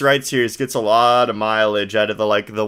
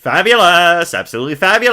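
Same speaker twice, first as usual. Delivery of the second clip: flamboyant showman; 7 semitones higher, 7 dB louder than usual. Acted. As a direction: ham it up like a stage magician. Voicing Max.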